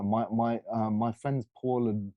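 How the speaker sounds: normal talking voice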